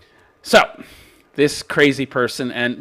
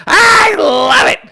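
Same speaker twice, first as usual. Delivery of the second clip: gleeful; 14 semitones higher, 6 dB louder, much slower than usual. Real, not acted. Laughing delight.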